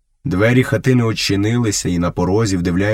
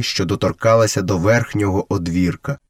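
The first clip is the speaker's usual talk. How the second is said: usual speaking voice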